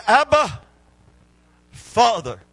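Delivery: high, loud cry of Abba, sharp on the first word. Retold or imitated. Jesus in the garden calling to his Father, loud cry.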